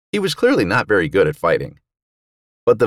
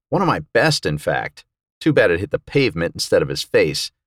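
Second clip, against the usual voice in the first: plain reading voice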